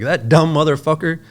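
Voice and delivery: loud, high mock guffawing insult; imitating John's laughing outburst. Telling the interviewer how John laughed at a man on Cops, loud and high. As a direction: blurt it out like the boss cracking up at the TV. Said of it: "he was like".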